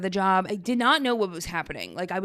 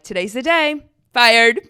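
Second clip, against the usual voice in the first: louder, punchy mock-triumphant fired; cheeky announcement; 2 semitones higher, 7 dB louder than usual. Texting friends an outfit photo, cheeky loud fired. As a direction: announce it like a headline.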